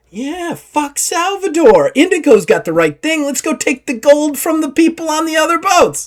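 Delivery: rally the crew against Salvador; rowdy pirate crowd. Acted. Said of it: "all the other pirates are like".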